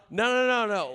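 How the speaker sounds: loud, high rapid-fire denial; emphatic interruption. Real, not acted. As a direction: break in over everyone. Interrupting himself over the others.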